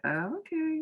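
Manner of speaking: squeaky high "oh, okay"; high mock surprise. Tressa caught off guard, squeaky high reply. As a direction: pitch it way up, thrown off. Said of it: "Tressa's like".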